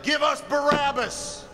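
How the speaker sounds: high, ringing cry of a mob; crowd's cry. Acted. Preacher voicing congregations turning on their pastor, crying out. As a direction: cry it out like the crowd demanding a prisoner. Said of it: "you cry out".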